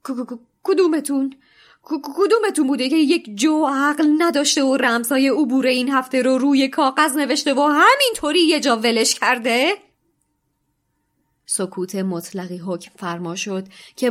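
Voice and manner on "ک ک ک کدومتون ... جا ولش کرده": loud, high stuttering fury; shaky stammer. A narrator's reading. McGonagall demanding who wrote the passwords, stammering. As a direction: stammer with shaking voice. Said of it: "با صدای لرزانی گفت".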